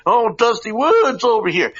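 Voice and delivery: high, loud mock call to the room; mock announcing. Host teasing co-host as Dusty Wood, mock call.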